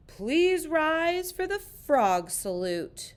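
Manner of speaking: a hint of a pompous teacher; formal teacher. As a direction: announce it grandly to the class. Teacher gives morning order.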